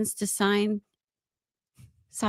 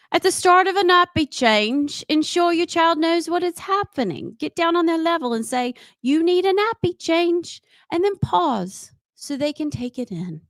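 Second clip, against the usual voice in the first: higher-pitched mock Australian newsreader; Australian accent; 4 semitones higher than usual. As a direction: read it like an Aussie presenter. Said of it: "fitting to do that in a British accent".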